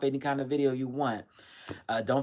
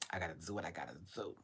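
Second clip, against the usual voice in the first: ordinary talk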